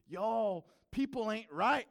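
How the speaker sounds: high, loud outburst of disbelief; loud exclamation. Retold or imitated. Voices his shocked verdict on the show.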